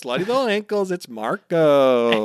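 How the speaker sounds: excited, stretched-out fanfare; ecstatic fan. Real, not acted. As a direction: croon the favourite's name with delight. Fan hailing favourite character's entrance.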